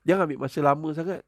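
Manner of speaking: ordinary talk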